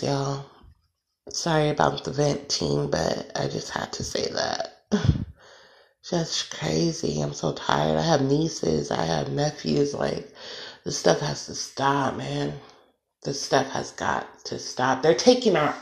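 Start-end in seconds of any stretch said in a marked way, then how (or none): none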